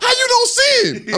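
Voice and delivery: loud high shout; furious yell. Retold or imitated. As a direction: yell it in disbelief. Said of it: "yelling back".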